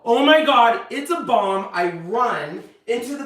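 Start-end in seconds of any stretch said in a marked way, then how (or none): 0.0-1.7 high, loud alarmed shout; acted cry of alarm; panicked yell. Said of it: "and I yell".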